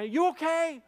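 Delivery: high, loud worried call; urgent concern. Retold or imitated. Driver checks the girl who hit him, high and urgent.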